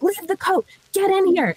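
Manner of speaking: high urgent call to hurry; frantic. Retold or imitated. Auntie calling her niece in from the nightclub door, frantic. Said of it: "she starts getting frantic".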